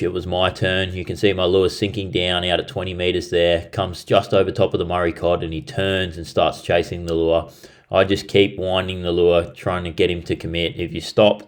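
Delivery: quiet, low retelling of the catch; calm voice-over narration. Acted. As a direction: narrate the replay calmly.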